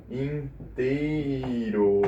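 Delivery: very slow, elongated "inteiro"; drawn out. Real, not acted. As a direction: stretch each syllable of "inteiro" right out. Stretches word while checking the verse.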